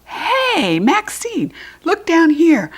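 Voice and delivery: calling up for attention; hollering. A narrator's reading. Judy B hollering up at Maxine. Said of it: "I hollered".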